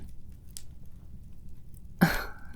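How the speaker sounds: a quiet, airy demon laugh; soft breathy chuckle. Acted. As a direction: chuckle under your breath, amused.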